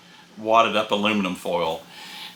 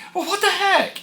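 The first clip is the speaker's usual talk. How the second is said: a much higher, strange-sounding what the heck; exasperated surprise. Retold or imitated. Husband finding the shower dry, high-pitched bafflement. Shower has no water either.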